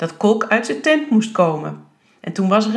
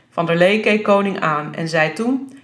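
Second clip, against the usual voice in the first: plain reading voice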